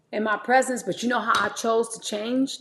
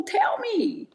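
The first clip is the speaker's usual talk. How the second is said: mock pleading; 11 semitones higher than usual. Pleading with friends, high pitch.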